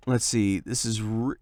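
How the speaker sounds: normal talking voice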